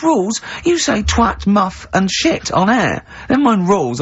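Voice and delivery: high-pitched, drawn-out disbelief; incredulous mock retort. Colleague scoffing at presenter's talk of rules, incredulous mock retort. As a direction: throw it back, high and incredulous.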